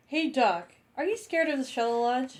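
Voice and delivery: a starry-eyed student volunteering; eager kid voice. Acted. A student begging to come along in a high kid voice.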